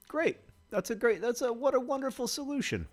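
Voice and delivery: high, mock-delighted sarcasm; sarcastic praise. Real, not acted. Gamer mocking a bad substitution, sarcastic high praise. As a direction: fake cheer at a bad outcome. Sarcastic reaction to game event.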